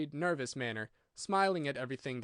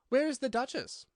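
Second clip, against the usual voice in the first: curious question; 10 semitones higher than usual. A narrator's reading. Alice asks the Rabbit.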